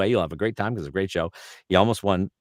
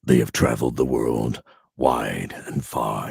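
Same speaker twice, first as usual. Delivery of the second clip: much lower, slow announcer voice; trailer voiceover; 7 semitones lower than usual. Shown as a demonstration. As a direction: intone it like a concert trailer. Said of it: "It was like".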